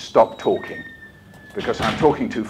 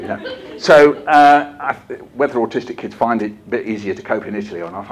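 ordinary talk, nothing marked

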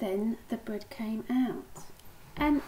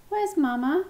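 higher, louder worried question; crying out; 6 semitones higher, 5 dB louder than usual. A narrator's reading. Pip cannot see Mama anywhere, crying out. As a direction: cry out for a missing parent. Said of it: "cried Pip".

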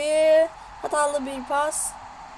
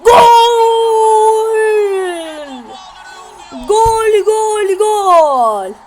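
screaming football commentator; 5 semitones higher, 9 dB louder, much slower than usual. Real, not acted. Goal celebration by commentator.

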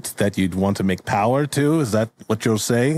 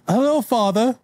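son greeting father. Acted. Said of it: "Oh, sorry, you're Beans".

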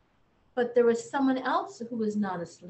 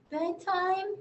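sleepy; 8 semitones higher than usual. A narrator's reading. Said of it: "said the boy sleepily".

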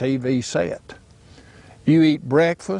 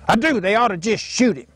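daddy's voice; 8 semitones higher than usual. Retold or imitated. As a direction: bark it out like a bigoted old Alabama daddy. Daddy insists in dialogue.

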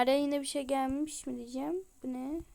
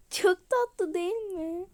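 gushing; 6 semitones higher, 5 dB louder, slower than usual. Real, not acted. Gushing over cute pet.

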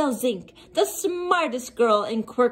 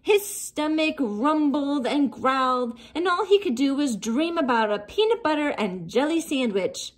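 plain reading voice

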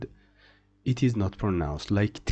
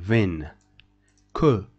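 usual speaking voice

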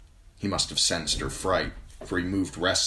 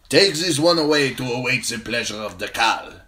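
higher, louder stern order; commanding captain; 6 semitones higher, 7 dB louder than usual. A narrator's reading. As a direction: bark the order at the guards. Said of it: "he commanded".

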